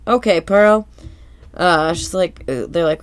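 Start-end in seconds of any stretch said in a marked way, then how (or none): 0.1-0.9 a touch of a mock stern boss; serious voice. SpongeBob starting to fire Pearl, lightly serious. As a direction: put on a mildly serious tone. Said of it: "serious voice".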